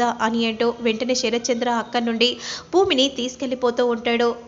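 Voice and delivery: usual speaking voice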